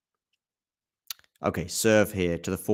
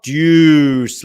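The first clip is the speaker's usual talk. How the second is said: loud, high announcement of the score; excited call; 7 semitones higher, 12 dB louder than usual. Real, not acted. Commentator calling deuce to viewers, loud excited announcement. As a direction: boom it out like a stadium announcer.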